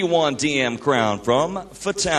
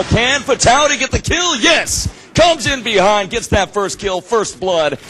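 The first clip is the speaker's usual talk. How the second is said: excited shout; 7 semitones higher, 5 dB louder than usual. Real, not acted. First kill lands.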